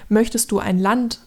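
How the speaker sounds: ordinary delivery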